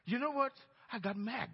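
ordinary talk

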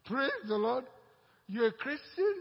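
as if you can't believe your ears; surprised disbelief. Retold or imitated. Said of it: "And the fellow said, Huh?".

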